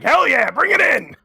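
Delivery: high-pitched mock whoop; gung-ho cheer. Imagining Texas staff welcoming props, a rowdy cheer. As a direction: cheer it on with gusto.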